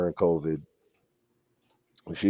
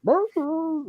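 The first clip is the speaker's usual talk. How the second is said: high yelped bark imitation; imitating a bark. Owner mimicking his barking poodle, high yip. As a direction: yip the second bark way up high like a tiny dog.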